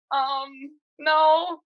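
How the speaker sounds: hesitate, then push out a retold refusal; awkward refusal. Acted awkward refusal to stranger.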